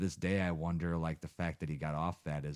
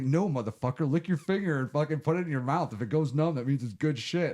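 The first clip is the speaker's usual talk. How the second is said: retold dealer, louder and higher, impatient; imitating a gruff dealer; 8 semitones higher, 7 dB louder than usual. Dealer in the car tells him how to test it, mock loud and impatient. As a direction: bark it like a pushy dealer schooling a newbie.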